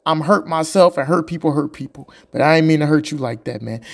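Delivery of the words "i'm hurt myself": normal talking voice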